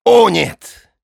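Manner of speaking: high, loud exclamation; a hint of a grand denial; emphatic don. Drachila exclaims.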